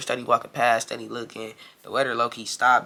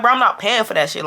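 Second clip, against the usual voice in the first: higher-pitched retold refusal; firm refusal; 7 semitones higher, 4 dB louder than usual. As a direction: refuse the bill in an argument. Refusing in acted quarrel.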